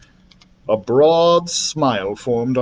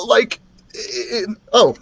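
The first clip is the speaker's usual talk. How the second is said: higher-pitched, halting character voice; mistaken dragon voice; 10 semitones higher than usual. A narrator's reading. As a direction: falter nervously, then break off. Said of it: "Lucas, not Dribble".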